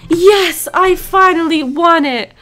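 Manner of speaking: loud triumphant yes. Real, not acted. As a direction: cheer the win. Wins after many tries.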